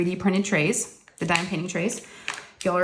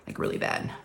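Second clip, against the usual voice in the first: whispered aside; 6 dB quieter than usual. Real, not acted. Confessing to being bad at water.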